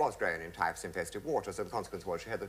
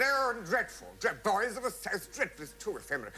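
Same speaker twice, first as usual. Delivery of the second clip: high-pitched mock dismay; appalled; 10 semitones higher than usual. Officer condemning the troupe's opening number, appalled. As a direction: object in theatrical horror.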